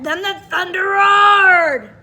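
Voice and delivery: loud, slow booming narration; roaring thunder. A narrator's reading. Teacher reading the thunder to the class, loud and booming. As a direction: boom it out like thunder.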